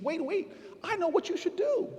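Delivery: high, eager mock voice of an inspired older woman; sudden bright idea. Neighbor suddenly inspired, telling the young councilman in a high, eager mock voice. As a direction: pitch it way up like a lightbulb just went on.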